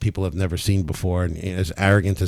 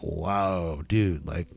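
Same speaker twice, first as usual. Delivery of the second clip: hushed, imitated awe; shocked onlookers; 3 semitones lower, 7 dB quieter, much slower than usual. Show audience to healer after healings, shocked awe. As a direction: drop the volume as if imitating dumbfounded people. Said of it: "these people were shocked".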